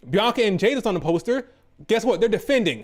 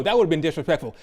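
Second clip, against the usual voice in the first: ordinary talk, nothing marked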